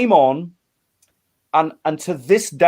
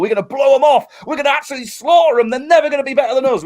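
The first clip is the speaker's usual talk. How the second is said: cocky boasting; 8 semitones higher, 3 dB louder, faster than usual. Retold or imitated. Cocky thoughts before the gig.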